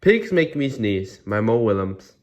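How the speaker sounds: usual speaking voice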